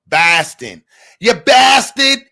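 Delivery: loud, high, drawn-out mock Boston accent. New Yorker mocking Boston people, exaggerated Boston accent. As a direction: exaggerate the flat A of Boston, slowly. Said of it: "Boston people accents is hilarious".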